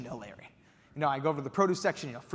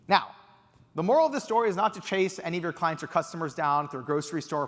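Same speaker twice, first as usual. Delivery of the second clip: ordinary delivery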